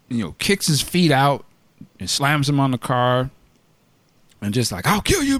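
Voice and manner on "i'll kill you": mock cop threat. Quoting the cop's threat.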